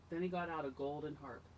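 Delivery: ordinary delivery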